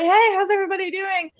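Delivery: high-pitched mock holler to a crowd; retold frontman shout. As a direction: imitate a singer hyping the room, without full volume. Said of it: "so he shouted out".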